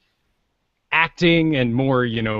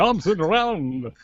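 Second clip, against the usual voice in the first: mock vampire; 5 semitones higher, 3 dB quieter than usual. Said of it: "Everyone else is just being dead serious".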